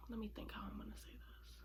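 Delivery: whispering to herself; whispered aside. Real, not acted. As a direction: murmur it under your breath. Aside while gathering her thoughts.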